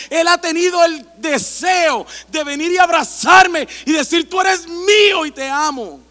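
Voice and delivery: slow, swelling sermon voice rising to loud; passionate preaching. Acted. Pastor telling the church of God's love, fervent preaching. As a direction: preach it with growing fervor.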